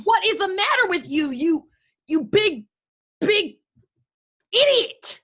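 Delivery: high, halting, climbing outburst; exasperated fury. Acted. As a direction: grope for the insult, then let it fly. Violent quarrel, mother insults son.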